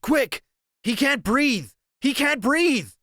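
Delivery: high, loud frantic cry for help; panicked shout. Acted. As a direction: yell for the nurse in panic. Calling nurse, friend choking.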